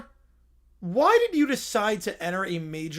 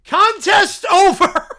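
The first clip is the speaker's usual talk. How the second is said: higher, louder, slow declaration; laughing bear shout; 9 semitones higher, 11 dB louder, slower than usual. Acted. Bear declaring the contest over, shouting and laughing. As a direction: bellow it while cracking up.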